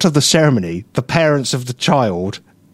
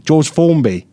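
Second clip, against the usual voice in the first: normal talking voice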